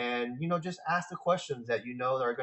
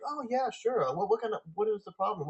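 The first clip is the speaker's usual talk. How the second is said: much higher, eager to help; helpful receptionist; 8 semitones higher than usual. Retold or imitated. Receptionist answering a caller who seems a customer, eager and higher. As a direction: play the friendly front-desk clerk. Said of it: "they'll be like".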